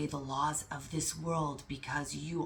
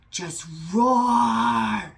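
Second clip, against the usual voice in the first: loud, high, drawn-out roaring shout; big motivational-speaker roar into the mic; 5 semitones higher, 10 dB louder than usual. Retold or imitated. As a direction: roar it out like a lion. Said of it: "him just roar in the microphone".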